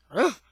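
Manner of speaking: short imitated dog ruff; dog bark. A narrator's reading. Dog answering the motor starting, imitated bark. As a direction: give a quick doggy bark. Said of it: "he goes when the motor starts".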